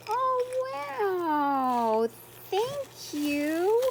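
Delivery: high squealing thanks; thanking a friend for a gift, squealing; delighted squeal. Real, not acted. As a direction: squeal your thanks, overjoyed. Thrilled opening a swap gift.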